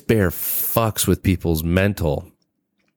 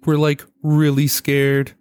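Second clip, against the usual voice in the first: mock scared woman; 8 semitones higher, slower than usual. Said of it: "Aniston also says".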